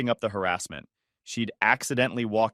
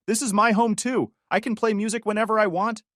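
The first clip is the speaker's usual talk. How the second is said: screaming through the door; 9 semitones higher than usual. Retold or imitated. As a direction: yell it through a closed door. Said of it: "she screamed through the door".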